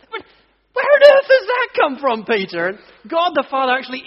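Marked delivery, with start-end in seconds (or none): 0.1-2.7 much higher, louder, laughing disbelief; incredulous laughing. Retold or imitated. Preacher mocking Peter's tent idea, high laughing disbelief. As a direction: squeal it through laughter, mock-baffled.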